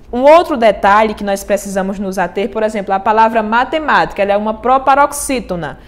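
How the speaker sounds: usual speaking voice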